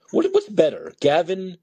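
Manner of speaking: usual speaking voice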